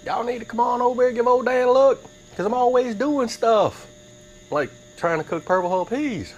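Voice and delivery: high, excited channel plug. Real, not acted. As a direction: hype it up like a sign-off.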